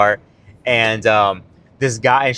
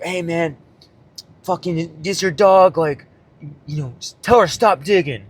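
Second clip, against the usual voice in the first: lightly voiced laid-back stoner, a bit higher; a hint of an old hippie drifting through the words; mild imitation of a stoner guy; 4 semitones higher than usual. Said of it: "old stoner hippie dude".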